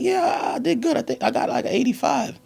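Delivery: high, loud, squeaky yeahs; a caught kid bluffing in a high squeal; nervous kid. Retold or imitated. Son answering his mother about the test, high and loud. Kid voiced lying to mom.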